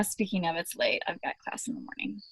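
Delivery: hushed. Real, not acted. Sleepy late night.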